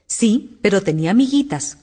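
ordinary delivery, nothing marked

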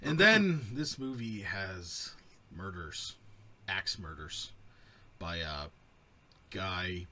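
slow, halting, word by word; talking like a computer. Real, not acted. Recapping the murders to co-hosts, halting computer-like delivery. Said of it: "You're talking like a computer now".

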